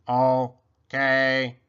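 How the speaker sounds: drawn-out monotone robot, loud. A narrator's reading. Robot agrees to Go Fish, drawn-out monotone. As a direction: hold both syllables on one note. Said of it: "mumbled Robot".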